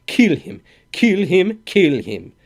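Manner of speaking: high, loud mocking chant; mocking mimicry. A narrator's reading. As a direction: mimic them with scorn. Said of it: "he mimicked".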